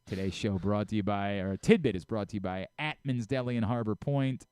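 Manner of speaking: brisk ad read for the local deli; easy host-read ad voice; sponsor read. Acted. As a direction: sell the deli like a live radio spot.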